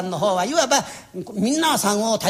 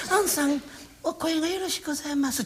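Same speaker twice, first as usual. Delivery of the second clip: a lightly voiced coaxing compliment; flattering teacher; 7 semitones higher, 5 dB quieter than usual. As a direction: sweet-talk the pretty girl. 若い娘をおだてる師匠. (軽くおだてる師匠の声)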